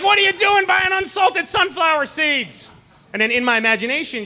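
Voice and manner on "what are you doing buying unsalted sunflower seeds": mock outraged shout. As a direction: yell it like a husband caught off guard. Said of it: "I'm like".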